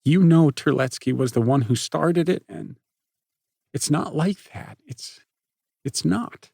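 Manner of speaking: plead, close to tears; pleading girl. Acted. Chloe pleading.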